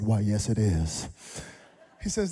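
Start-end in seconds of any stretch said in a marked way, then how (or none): 0.0-1.1 lower, slow, proper reply; mock formal. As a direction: answer with pretend dignity. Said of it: "I said".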